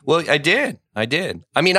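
usual speaking voice

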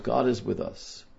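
ordinary delivery

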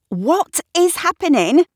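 high, loud, incredulous question; bewildered. A narrator's reading. Kat watches mice climb the wall, bewildered. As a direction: demand an explanation in disbelief.

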